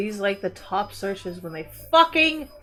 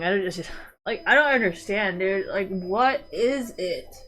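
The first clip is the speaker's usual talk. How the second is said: ordinary talk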